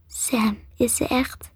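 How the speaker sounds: hushed, hesitant question; whispering. A narrator's reading. Maree to Sam after Crystal's death, whispering. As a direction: whisper it softly in shock. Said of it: "fluisterde Maree".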